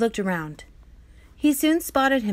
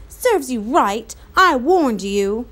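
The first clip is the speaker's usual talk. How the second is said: slow, louder told-you-so; scolding; 5 dB louder, slower than usual. Acted. Omri scolding Patrick for using the cupboard, slow and stern. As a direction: drag out the reproach.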